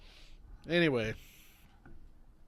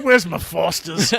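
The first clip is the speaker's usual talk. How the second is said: slow, hammed-up Australian accent with a laugh; mock Australian accent; 4 dB louder, slower than usual. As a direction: play a cartoon Aussie asking for his beer. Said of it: "Your accent is terrible".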